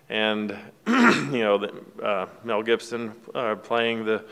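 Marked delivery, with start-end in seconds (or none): none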